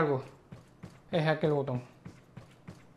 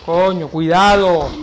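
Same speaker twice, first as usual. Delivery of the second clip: slow, loud stretched warning; shouting; 11 dB louder, much slower than usual. Real, not acted. Warning during gameplay. (aviso gritado y lento)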